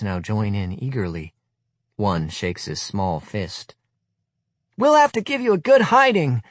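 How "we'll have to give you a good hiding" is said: put-on much higher, loud piping child voice; high-pitched boy. Small boy threatening the veterans, piping high voice. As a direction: pipe it like a cocky small boy. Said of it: "he pipes up in his high-pitched voice".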